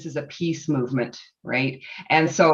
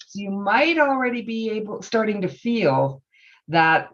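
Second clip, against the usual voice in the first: ordinary talk, nothing marked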